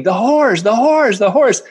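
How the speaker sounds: high-pitched mock yell, not full volume; mock screaming. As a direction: imitate her screaming it over and over, pitched high. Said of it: "she starts screaming".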